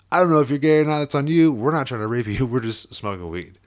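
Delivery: loud, high amused brush-off; chuckling reply. Retold or imitated. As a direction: laugh it off at him.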